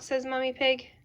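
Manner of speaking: ordinary delivery, nothing marked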